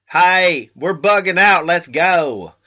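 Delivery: bright mock Wisconsin accent, a quick hammed-up bit. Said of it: "that was my Wisconsin accent".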